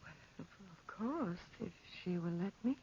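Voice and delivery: timid, frightened near-whisper; fearful hush. Acted. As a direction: barely dare to say it. Fearful reluctance approaching the panther.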